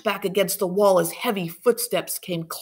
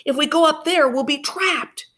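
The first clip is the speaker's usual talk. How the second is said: urgent warning; 9 semitones higher, 4 dB louder than usual. Acted. Duffy warning.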